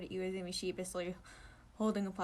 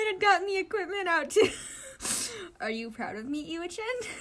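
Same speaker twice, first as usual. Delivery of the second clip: laughing proudly; 10 semitones higher, 7 dB louder than usual. Acted.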